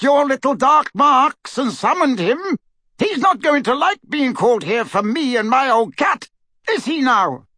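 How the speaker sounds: high-voiced, loud gruff old barman daring them; a cantankerous publican daring them to arrest him; stubborn old barman standing his ground; defiant old barman. Barman defies the Death Eaters.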